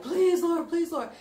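high, loud, cracking cry to God; desperate tearful plea. Retold or imitated. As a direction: beg through big tears. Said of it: "I was like".

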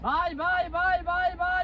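high, soft sing-song calls; high bird call. Real, not acted. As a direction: coax the hawk down gently. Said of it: "ਬਾਏ ਮਾਰ".

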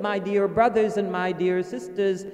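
usual speaking voice

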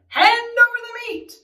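high, loud pirate order; loud demand. Acted. Pirate captain demands food at dinner, loud order. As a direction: demand it across the table. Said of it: "Braidbeard gulped his food and said".

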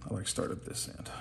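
breathy murmur to himself; whispered aside. Real, not acted. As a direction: mutter it under the breath. Muttered aside while painting.

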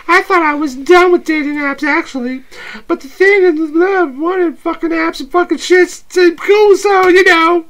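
playful date voice, high and bright; high girly voice. Acted. S0 holds Abby voice.

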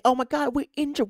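high mocking voice of the club's excuse. Fan mimics the club blaming injuries, mocking high whine. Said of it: "we're gonna say".

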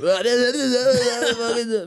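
high, rapid gibberish babbling; mock garbled babble. Comic retells nervous first stage appearance, mock garbled babble.

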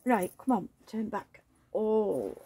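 ordinary talk

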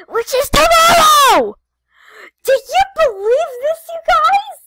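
high loud excited yell; excited shout. Real, not acted. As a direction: shout it with pure joy. Shouting excited about Christmas.

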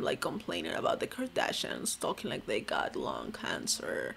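raspy low vocal fry imitation; fry it out low and croaky. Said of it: "vocal fry this whole time".

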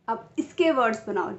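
normal talking voice

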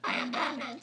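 extremely deep, hushed Batman effect; dark voice. Shown as a demonstration. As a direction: drop to the very bottom, softly. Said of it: "like this".

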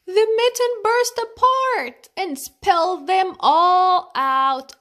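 loud, high sudden climax; excited burst. A narrator's reading. Mitten bursts apart.

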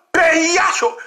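high-pitched mimicked cry; mimicked outcry. Commentator mimicking the alarmists, high outcry. Mimicking what they keep saying.

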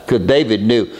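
ordinary talk, nothing marked

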